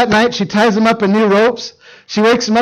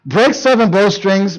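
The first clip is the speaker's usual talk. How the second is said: ordinary talk, nothing marked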